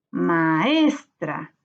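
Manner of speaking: "Maestra" slowly, with ES lifted and lengthened; stressed syllable stretched. Shown as a demonstration. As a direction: lean on the ES of "Maestra". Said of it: "Es en la sílaba tónica".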